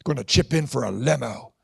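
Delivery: lightly voiced deep-voiced son. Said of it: "got a deeper voice".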